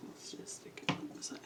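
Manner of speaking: whispered aside to herself; soft whispered aside. Real, not acted. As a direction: breathe it out under her breath. Muttered aside while working.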